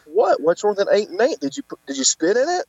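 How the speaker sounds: high-pitched, baffled protest; retold incredulous questioning, pitch climbing; mock indignant. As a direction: demand an explanation in playful disbelief. Acts out protest at being laughed at.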